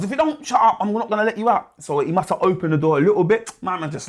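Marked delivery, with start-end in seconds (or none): 0.0-1.7 high, mock threat; screw's threat. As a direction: threaten him in a raised voice. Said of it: "And then he goes".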